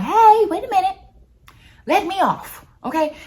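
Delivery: high, loud panicked shout to stop; alarmed calling out. Retold or imitated. Rider yelling to get off the roller coaster, alarmed shout. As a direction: call out urgently, pitch jumping way up. Acted cry to stop the ride.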